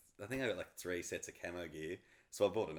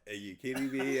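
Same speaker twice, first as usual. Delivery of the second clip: laughing disbelief; 5 semitones higher, 6 dB louder than usual. Retold or imitated. Told of wife's surprise trip.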